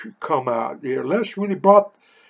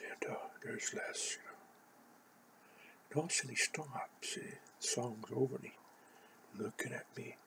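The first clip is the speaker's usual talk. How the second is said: whispered, hushed; whispering; 4 semitones lower, 26 dB quieter than usual. Real, not acted. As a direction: whisper the memory. Whispered stretch in story.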